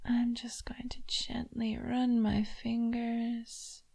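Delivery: slow soft unvoiced whisper. Mistress teasing her toy with a slow whisper. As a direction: drag each word out, breathy and teasing.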